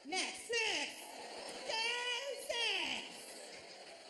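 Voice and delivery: "Just next" squawked high as one mashed word; mimicked lazy "Just next", squeezed and high; slurred counter call. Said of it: "she was barely trying to speak".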